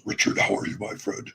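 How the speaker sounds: softly husky devil voice; quiet, smooth devil role-play; Satan. Acted. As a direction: greet him in a hushed, breathy deep voice. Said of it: "And so Satan goes".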